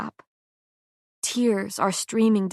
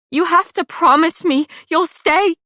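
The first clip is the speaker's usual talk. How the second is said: higher, louder desperate plea; tearful begging; 9 semitones higher, 7 dB louder than usual. A narrator's reading. Pei begs her dying friend to promise. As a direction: beg through tears.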